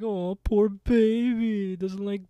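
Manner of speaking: put-on high, loud baby-talk taunt; drawn-out fake sympathy; mock pity. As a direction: tease as if cooing at a baby. Kiki voiced mocking David's new school.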